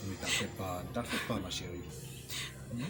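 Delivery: low, soft comforting murmur; tender reassurance. Acted. Man telling his pregnant sweetheart not to worry, soothingly. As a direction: soothe a loved one quietly.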